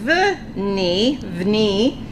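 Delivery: vni said very slowly, syllable by syllable; slow sounding out. Shown as a demonstration. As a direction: drag vni out for the class. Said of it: "This word is vni".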